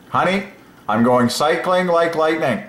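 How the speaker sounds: hammed-up husband boasting to his wife; playful husband. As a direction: brag to your honey on the way out.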